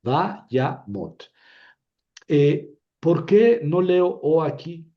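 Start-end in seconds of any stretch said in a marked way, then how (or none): 0.0-1.3 Vayamot in three separated beats; syllable by syllable. Shown as a demonstration. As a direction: chop Vayamot into va, ya, mot. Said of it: "la tercera sílaba es Mot".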